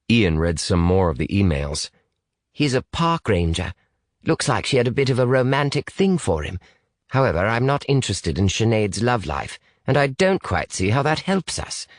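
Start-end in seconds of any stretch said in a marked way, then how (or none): 2.5-11.9 higher, slightly stiff British voice; reserved British boy. Acted. British boy doubts the emails help, reserved and sceptical. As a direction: sceptical Brit, pitched a little up.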